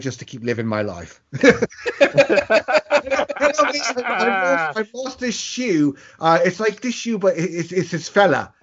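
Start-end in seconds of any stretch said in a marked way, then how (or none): 1.4-8.5 put-on Russell Brand London accent. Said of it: "trying to do a London accent".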